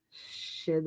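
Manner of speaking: unvoiced whispered "Shit"; regretful whisper; whispered curse. Real, not acted. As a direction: curse under your breath. Self-reproach.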